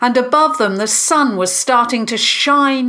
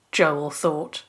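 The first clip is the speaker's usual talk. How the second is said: ordinary delivery, nothing marked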